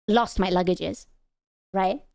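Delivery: ordinary delivery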